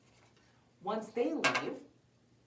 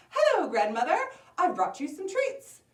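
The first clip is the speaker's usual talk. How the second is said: higher, chirpy greeting; little girl voice; 9 semitones higher, 7 dB louder than usual. Acted. Little girl greeting her grandmother.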